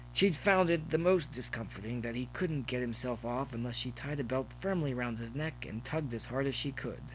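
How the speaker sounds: usual speaking voice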